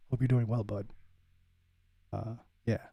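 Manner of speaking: ordinary talk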